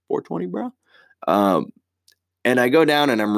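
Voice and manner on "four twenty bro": higher, softer repeat of "Four twenty, bro"; goofy stoner echo. Shown as a demonstration. Joking about the 4.2 rating, higher, softer stoner echo. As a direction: toss off "bro" high and light.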